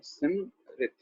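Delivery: सिमरित broken up, sim, then rit; correct pronunciation. Shown as a demonstration. Teacher spelling out सिमरित in parts, ending rit. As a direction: end सिमरित on a short i, rit. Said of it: "सिमरित उच्चारण होगा".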